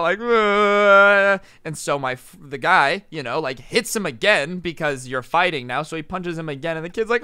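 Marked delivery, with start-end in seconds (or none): 0.2-1.4 long, loud fake crying; hammed-up drawn-out wail; mock freak-out wail. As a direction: imitate a kid bawling in panic. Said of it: "freaking out".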